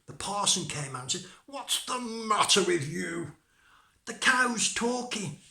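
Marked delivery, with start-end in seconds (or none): 1.5-3.4 loud, high, drawn-out question; exasperated parson. Acted. Parson to the screaming maid, loud and drawn out. 4.1-5.4 high, loud, stretched-out words; alarmed maid. Acted. Maid tells the parson about the cow, high alarm.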